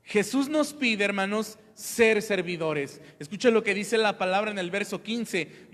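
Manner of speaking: loud, high, driving sermon voice; fervent preaching. Acted. Pastor urges the church to serve, preaching loud. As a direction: preach it out to the congregation.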